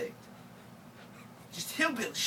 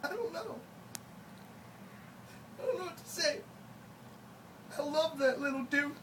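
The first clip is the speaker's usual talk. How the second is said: choked up; 6 semitones higher, 6 dB quieter than usual. Acted. Mock sad breakdown over brother.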